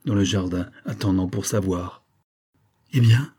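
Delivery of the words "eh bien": plain reading voice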